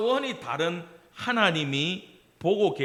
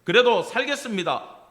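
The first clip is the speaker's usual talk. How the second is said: resolute vow; 6 semitones higher, 8 dB louder than usual. Shown as a demonstration. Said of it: "각오하고".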